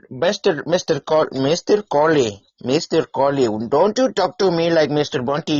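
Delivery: high, hammed-up Indian accent. Shown as a demonstration. Accent lover's second try for a learner, exaggerated Indian accent. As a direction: play a mock-indignant Indian-accented character. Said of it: "'cause Indian, it, I used to".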